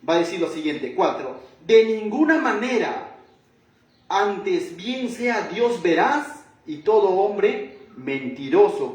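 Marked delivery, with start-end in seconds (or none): 1.7-8.9 slow, loud emphatic scripture quote; firm, deliberate proclamation; categorical reading. Retold or imitated. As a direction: pound out each word of the verse. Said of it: "Pablo es categórico con su respuesta".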